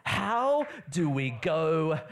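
usual speaking voice

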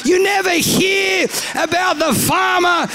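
high, shouted comic rant; angry. Retold or imitated. Student fed up with his farmer name, high angry ranting. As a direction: rail at the unfairness, high-pitched. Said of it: "I'm angry with God".

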